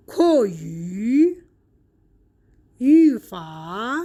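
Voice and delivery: slow word list from "نی ها" to "شوبیا", each second tone then dipping third; deliberate Mandarin sandhi drill, "نی ها" to "شوبیا" in tones two-three; tone two plus three. Shown as a demonstration. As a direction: draw out each word, "نی ها" through "شوبیا", ending on a rise.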